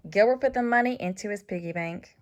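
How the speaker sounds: ordinary delivery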